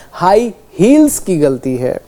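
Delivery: "Heels" slowed and stressed, with a clear s; correcting the wrong word "हील" to "heels" slowly; stressed correction. Shown as a demonstration. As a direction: lean on the s of "heels". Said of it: "heels होता है".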